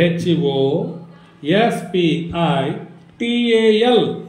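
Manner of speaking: slow chunks: H ఓ, ఎస్ పి ఐ, టి ఏ ఎల్; grouped spelling. Shown as a demonstration. Teacher shows pupil how to combine letters, in slow groups. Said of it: "కలిపి చెప్పుకోవచ్చుగా".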